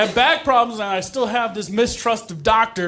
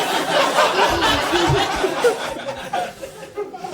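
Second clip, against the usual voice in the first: crowd laughter; 12 semitones higher than usual. Real, not acted. Audience laughs at flub.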